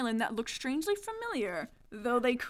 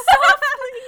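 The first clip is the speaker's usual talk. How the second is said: laughing, higher, louder and slow; 15 dB louder than usual. Real, not acted. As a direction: giggle through the words. Laughs through narration.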